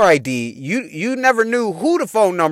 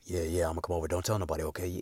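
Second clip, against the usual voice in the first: put-on much lower, soft sneaky boyfriend voice; low Tim voice; 13 semitones lower, 18 dB quieter, faster than usual. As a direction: play the boyfriend whispering plans. Voicing Tim on the phone.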